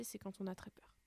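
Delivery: soft unvoiced whisper. Real, not acted. Teacher explaining fear to pupils, whispered. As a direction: whisper it as if scared.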